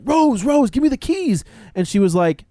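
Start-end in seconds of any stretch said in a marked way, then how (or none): 0.0-1.4 much higher, mock yell; high, insistent retold shouting; urgent angry demand. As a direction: imitate him losing patience. Retold escalating demand for keys.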